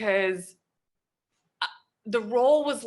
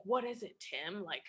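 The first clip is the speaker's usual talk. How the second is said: director asking quietly; 8 dB quieter than usual. Retold or imitated. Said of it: "the director's like".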